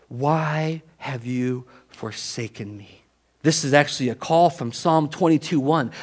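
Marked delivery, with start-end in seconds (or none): 0.1-3.0 slow, soft, drawn-out plea; forsaken lament. Acted. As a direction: let each word hang, quietly. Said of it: "cry out from the cross".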